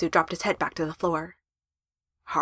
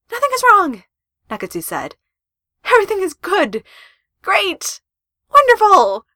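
loud, very high, overeager; panicked insisting; 11 semitones higher, 7 dB louder than usual. Acted. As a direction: insist too brightly, rising into panic. Panicked over-insisting to girlfriend.

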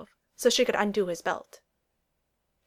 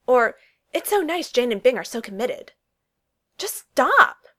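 angry confrontation; 4 semitones higher, 6 dB louder than usual. Acted. Lizzie confronts Darcy, escalating.

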